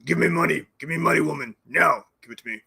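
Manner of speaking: put-on voice demanding money, fading out; mock demanding. Mock Zelensky demanding money from woman, lightly voiced. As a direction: demand it like a greedy caricature. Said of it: "this little old poor turtle came over".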